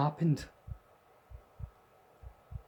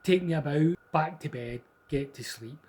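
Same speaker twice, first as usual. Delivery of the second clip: brisk, louder fed-up dad; impatient dad; 3 semitones higher, 7 dB louder than usual. Retold or imitated. Father dismissing the ghost, brisk and impatient. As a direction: get it over with, dad. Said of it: "He was just like".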